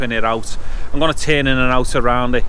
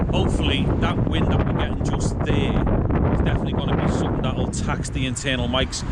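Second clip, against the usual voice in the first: wind on mic; 5 dB quieter than usual. Real, not acted. Internal mics tested in strong wind.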